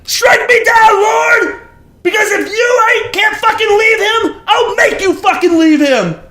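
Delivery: melodramatic, tearful-edged raving; ranting at God. Acted. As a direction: bellow a challenge up to heaven. Acted ranting dare to God.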